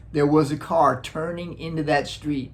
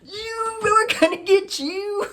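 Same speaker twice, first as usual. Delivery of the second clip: mock teen threat; slower than usual. Teenagers threatening again.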